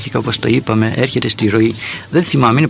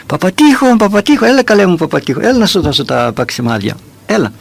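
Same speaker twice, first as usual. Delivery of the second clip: kindly calling out; 7 semitones higher than usual. Retold or imitated. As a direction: call him back nicely, as in a retold story. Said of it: "φώναξε τον με καλόν τρόπο". (καλοπροαίρετο φώναγμα, σε μίμηση)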